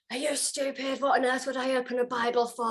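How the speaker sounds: high, fast mock outrage at the phone; exasperated retold rant, high and quick; mock shouting. Shouting at the friend on the phone, mock outrage. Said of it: "I was shouting at the phone".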